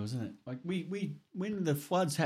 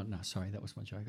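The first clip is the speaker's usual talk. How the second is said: normal talking voice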